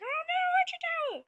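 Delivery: squeaky panic. Retold or imitated. A troop spots the archer tower in a high squeal. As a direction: squeal it like a tiny soldier. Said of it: "he's like".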